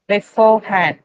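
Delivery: beforehand with stress on the second syllable, be-FORE-hand; stress demo. Shown as a demonstration. Teacher modelling a word for students, loud stress demo. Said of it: "an example like beforehand".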